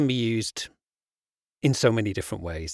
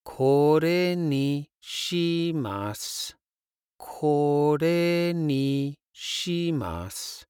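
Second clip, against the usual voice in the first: slow; 3 semitones higher, much slower than usual. Shown as a demonstration. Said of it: "pointing towards something on the menu".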